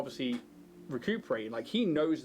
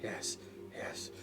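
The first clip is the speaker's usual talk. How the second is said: muttering in a trance; 4 semitones lower, 9 dB quieter, much slower than usual. Acted. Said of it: "it's like".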